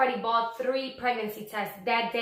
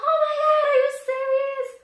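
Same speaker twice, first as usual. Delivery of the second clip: much higher, louder squeal of disbelief; mom's excited squeal; 8 dB louder than usual. Retold or imitated. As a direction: shriek it like a thrilled mom hearing the news. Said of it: "she was like".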